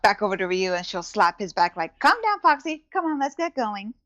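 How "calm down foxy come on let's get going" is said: higher, lilting nudge to a friend; playful coaxing. Acted. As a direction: chivvy a sulking friend along in a high voice. Jezebel soothes Ryu, slapping his back.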